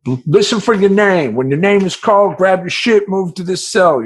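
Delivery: loud, high guard call-out; guard barking instructions at inmates; jail guard announcing. Retold or imitated. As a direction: call it out like a bored guard to the block.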